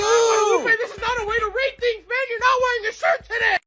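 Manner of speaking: squeaky high scolding voice; high-pitched voice. Acted. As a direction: pitch way up and fuss at him.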